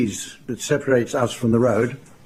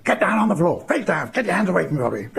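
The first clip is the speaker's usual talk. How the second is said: high hammed-up robber yelling, fast at the end; imitating a gunman ordering everyone down; mock robber shout; 7 semitones higher, faster than usual. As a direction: bark it like a movie robber, half in jest. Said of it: "they came in shouting".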